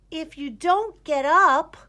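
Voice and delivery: high, loud and slow urging; insistent urging. A narrator's reading. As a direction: push him to get up. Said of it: "said Greta".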